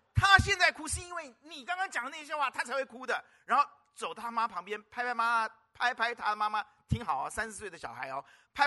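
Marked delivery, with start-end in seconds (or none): 0.1-3.2 put-on higher, insistent child voice, stress on 她; small daughter pinning the blame on mom; little girl scolding. Girl's words repeated, pressed harder.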